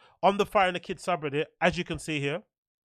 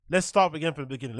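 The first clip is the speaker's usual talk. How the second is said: ordinary talk, nothing marked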